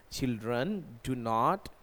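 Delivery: very slow model answer; slow dictation. Shown as a demonstration. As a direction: spell out the negative sentence word by word. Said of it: "ऐसे ही बोलना है हमें". (धीमे से नकारात्मक वाक्य)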